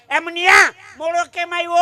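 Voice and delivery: high, loud mock telling-off; teacher's scolding shout. Teacher to a boy late for school, scolding shout. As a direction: bark it at a tardy pupil.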